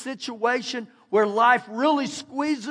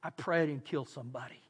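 low, much softer inner prayer; hushed prayer; 6 semitones lower, 11 dB quieter than usual. Retold or imitated. Driver after a crash praying nobody died, hushed. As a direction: breathe it like a worried thought. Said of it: "I thought".

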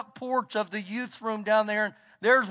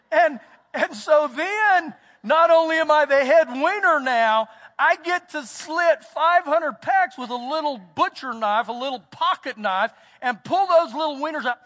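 emphatic preaching; 4 semitones higher, 5 dB louder than usual. Acted. Preaching with rising force.

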